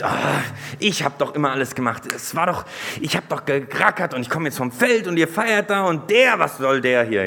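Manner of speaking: loud, high mock-whining older brother; whiny complaint. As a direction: play the sulking brother who did everything. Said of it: "Es ist dieses". (gespielt jammernd, laut und hoch, der beleidigte Bruder)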